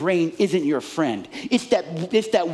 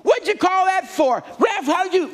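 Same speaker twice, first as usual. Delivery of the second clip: heckling fan; 10 semitones higher than usual. Retold or imitated. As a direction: yell at the pass like a fan in the stands. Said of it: "These people up here go".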